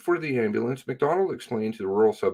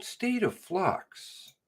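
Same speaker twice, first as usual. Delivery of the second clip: sarcastic quoting; 4 dB quieter, much slower than usual. Real, not acted.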